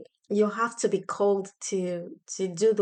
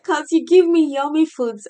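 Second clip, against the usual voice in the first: put-on higher and much louder child voice; excited child repeat; 7 semitones higher, 10 dB louder than usual. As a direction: burst it out as an eager kid. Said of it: "She said".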